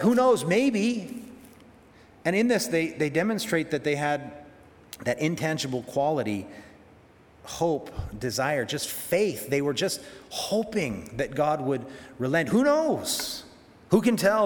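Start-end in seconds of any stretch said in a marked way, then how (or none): 0.0-1.2 hopeful shrug. Retold or imitated. Voicing hopeful Ninevites. 12.5-14.5 high, hoping against hope, a little louder; hopeful wondering. Retold or imitated. Preacher voicing the Ninevites, high and hopeful. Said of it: "they say".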